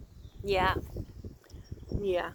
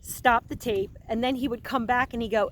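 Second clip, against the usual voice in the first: ordinary delivery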